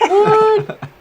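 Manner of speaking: very high, loud laughing yelp; incredulous. Real, not acted. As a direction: shriek it in delighted disbelief. Disbelief that Wayne likes someone.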